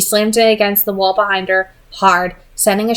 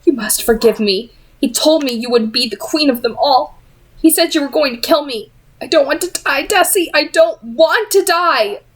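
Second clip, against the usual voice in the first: frightened plea; 6 semitones higher than usual. A narrator's reading. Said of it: "to hiss a whisper into her ear".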